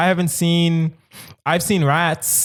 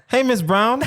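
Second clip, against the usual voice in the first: calling out; 8 semitones higher than usual. Real, not acted. Calling out to friend's mom.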